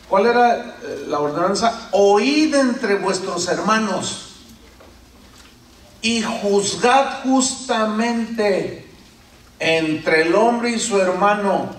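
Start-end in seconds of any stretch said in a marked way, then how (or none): 1.9-11.7 slow, solemn reading of the verse; scripture reading. Acted. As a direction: lean on every word of scripture. Reads Bible verse aloud. (lectura bíblica lenta y solemne)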